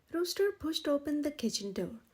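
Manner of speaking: ordinary delivery, nothing marked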